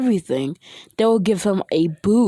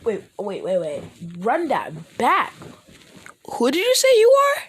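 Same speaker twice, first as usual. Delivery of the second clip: shocked; 5 semitones higher than usual. Acted. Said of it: "They'll be like".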